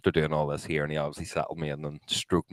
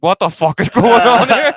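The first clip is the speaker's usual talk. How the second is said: loud, high-pitched disbelief; shocked outburst; 15 dB louder than usual. Retold or imitated. Retelling his body moving by itself, loud shock. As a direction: blurt it out in astonishment, laughing.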